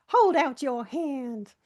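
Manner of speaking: slow, drawn-out granny voice; a hint of an old granny offering a treat; old granny impression. Retelling a friend's biscuit routine, slow old granny voice. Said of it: "doing an impression of that old granny".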